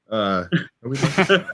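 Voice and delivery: slow, drawn-out live check; Chris Perillo voice. Retold or imitated. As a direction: slowly ask if the stream is live. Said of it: "in the words of, uh, Chris Perillo".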